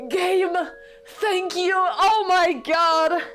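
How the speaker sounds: high, loud cheering shriek; ecstatic shouting. Real, not acted. Streamer cheering loudly when he finally confesses. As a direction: scream with pure joy.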